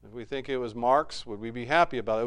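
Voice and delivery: normal talking voice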